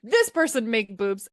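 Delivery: ordinary talk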